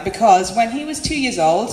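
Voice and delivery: ordinary talk, nothing marked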